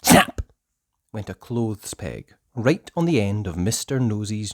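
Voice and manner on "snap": ordinary delivery, nothing marked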